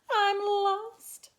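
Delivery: high, loud wail. A narrator's reading. Spinderella finds herself outside alone, high loud wail. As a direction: wail it like a lost little creature. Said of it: "she wailed".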